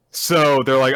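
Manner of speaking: usual speaking voice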